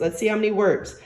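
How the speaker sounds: ordinary talk, nothing marked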